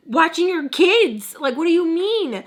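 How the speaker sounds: high, loud, slow snap back; fed-up comeback; exasperated retort. Retold or imitated. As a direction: answer him like it's obvious. Snapping back at the ex.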